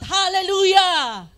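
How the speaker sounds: huge, drawn-out praise shout; joyful shout. Real, not acted. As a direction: lead the congregation in a joyful shout. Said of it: "Joyful shout". (masayang sigaw ng papuri)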